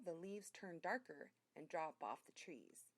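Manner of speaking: ordinary delivery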